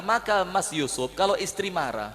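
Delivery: usual speaking voice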